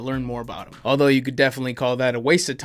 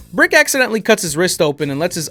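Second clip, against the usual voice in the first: usual speaking voice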